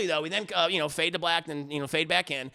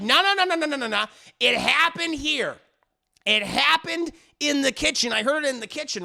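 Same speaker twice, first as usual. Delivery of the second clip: loud, raised rapid-fire no's, then emphatic; insistent contradiction; 7 semitones higher, 5 dB louder than usual. Retold or imitated. Husband insists noise came from the kitchen, loud and insistent. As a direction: cut her off, then drive each point home.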